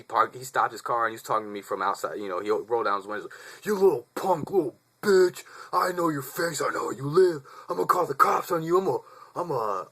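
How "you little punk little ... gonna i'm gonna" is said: high, loud mock rant of an angry driver; angry threats. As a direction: bark threats out of a car window. Driver threatens him from the car.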